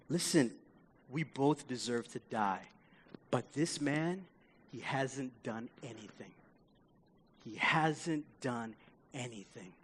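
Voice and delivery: low, soft, measured confession; solemn, hushed. Acted. Dying thief defending the innocent man, solemn and hushed. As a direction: drop right down, grave and sincere.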